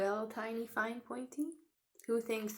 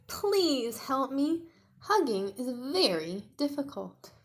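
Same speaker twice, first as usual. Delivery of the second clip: slow, loud, higher plea; Tiny pleading; 5 semitones higher, 7 dB louder than usual. Acted. Tiny asking new friends for help, pleading. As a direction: beg for help like a struggling little T-Rex.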